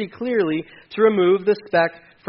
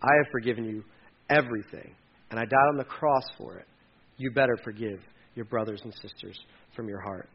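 stern Jesus; 8 semitones lower, 7 dB quieter than usual. Retold or imitated. Jesus rebuking the unforgiving believer, low and stern. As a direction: speak quietly but firmly like Jesus correcting. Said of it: "And Jesus is like".

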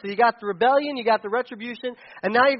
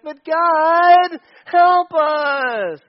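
high, whiny plea; high whiny "But God" plea; whining; 9 semitones higher, 10 dB louder, much slower than usual. Retold or imitated. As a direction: beg like a whining child. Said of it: "exactly what the Midianites are doing".

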